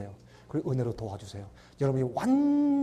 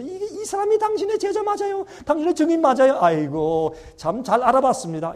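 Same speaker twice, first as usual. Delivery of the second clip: much higher, sneering and taunting; mocking devil; 10 semitones higher, 7 dB louder than usual. The devil taunts Jesus about his disciple, sneering. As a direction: jeer at the sinner like a gloating devil. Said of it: "이러고 빈정거리고 놀려요".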